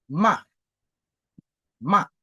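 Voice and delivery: "ma" falling sharply; short, emphatic drop on "ma"; fourth tone. Shown as a demonstration. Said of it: "le quatrième ton".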